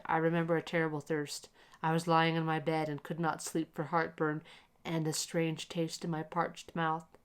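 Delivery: ordinary delivery, nothing marked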